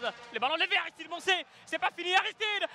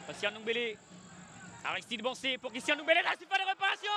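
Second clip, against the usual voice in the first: ordinary talk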